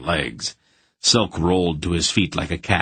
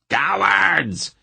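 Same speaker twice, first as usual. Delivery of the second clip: Cowards! much higher, a furious shrill repeat; furious shout; 12 semitones higher than usual. A narrator's reading. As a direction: scream Cowards! again, pitch way up. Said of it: "he shouted at his hirelings".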